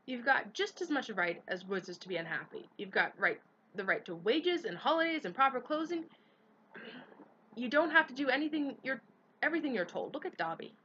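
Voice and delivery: rally the elves; impassioned speech. A narrator's reading. Hermione lectures elves.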